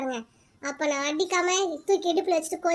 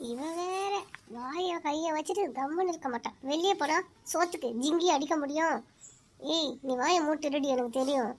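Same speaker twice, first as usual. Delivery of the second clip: lower, much softer grumble under the breath; muttered aside; 4 semitones lower, 7 dB quieter than usual. Acted. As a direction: grumble to yourself so no one hears. Muttered aside about stepdaughter.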